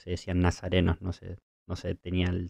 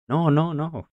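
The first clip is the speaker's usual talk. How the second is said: higher-pitched mock protest; protesting refusal; 8 semitones higher, 3 dB louder, slower than usual. Peter refusing to eat in his vision, protesting. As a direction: act out a startled refusal, rising high.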